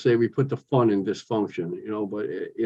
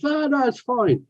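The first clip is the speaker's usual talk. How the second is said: high, drawn-out brush-off; imitated easygoing dismissal; retold reassurance. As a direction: wave off an apology in a high, slow voice. Customer waving off his apology.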